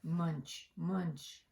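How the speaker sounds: plain reading voice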